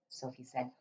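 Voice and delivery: ordinary delivery, nothing marked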